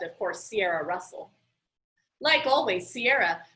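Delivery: usual speaking voice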